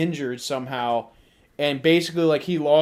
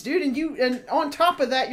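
normal talking voice

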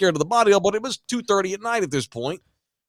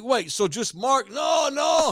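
mock yelled confusion; 6 semitones higher, much slower than usual. Quoted back-and-forth about the name.

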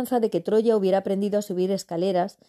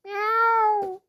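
high drawn-out meow; cat meow. Acted. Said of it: "dijo Pantalones".

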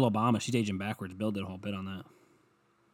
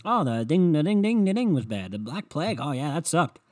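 higher, louder, mock casual tone; lightly voiced flippant kids; imitating classmates shrugging off plagues; mock schoolkid; 5 semitones higher, 5 dB louder than usual. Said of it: "we were like".

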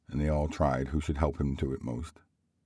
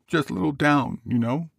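high, raised character voice; Bob's voice; 12 semitones higher, 4 dB louder than usual. Acted. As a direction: lift it high for Bob's quoted words. Bob's words voiced, much higher.

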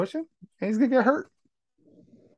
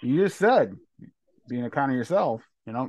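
usual speaking voice

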